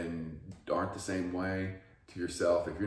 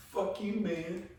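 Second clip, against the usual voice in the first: mock retort; 13 semitones higher, slower than usual. Answering the imagined trainer.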